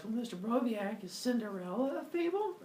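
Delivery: put-on high-pitched child's voice; put-on kid's question, pitched high; asking kid. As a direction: ask it like a curious little pupil. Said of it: "Then kids will ask".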